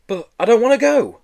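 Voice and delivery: high, loud desperate protest. A narrator's reading. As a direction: plead in panic. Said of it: "Joe protested".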